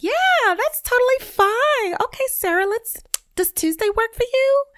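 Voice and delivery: sweet fake-polite coworker tone; code-switching voice. Podcaster mocking her code-switched voice to Sarah, high and chirpy. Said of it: "when you code switch and when you're like".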